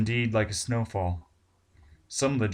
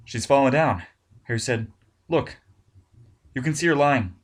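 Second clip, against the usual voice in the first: alarmed; 5 semitones higher, 7 dB louder than usual. Acted. Harry alarmed at porthole.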